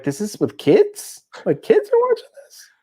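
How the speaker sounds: disbelief climbing very high at the end; incredulous. Retold or imitated. Viewer appalled that kids watch this show, incredulous. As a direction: question it in mounting, high-pitched disbelief.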